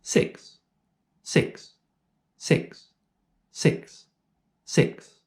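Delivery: six said short and clipped off; each six said short and clipped, voicing barely on; short phonation intervals. Shown as a demonstration. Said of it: "I will say a word with a short phonation interval".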